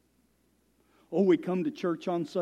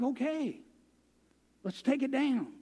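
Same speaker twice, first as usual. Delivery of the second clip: high, soft giving-in; imitated meek compliance; 7 semitones higher, 5 dB quieter than usual. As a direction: give in softly, pitched up high. Acting out his meek compliance.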